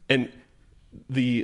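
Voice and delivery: ordinary talk, nothing marked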